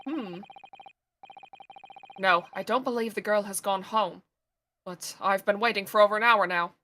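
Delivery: lightly voiced low, quiet sleuth; measured gentleman detective, understated; calm detective voice.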